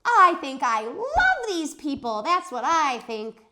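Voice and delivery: high, loud, slow joyful cry; hollered. Acted. Junie B hollers her joy at her classmates. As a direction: burst out with love. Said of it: "I hollered".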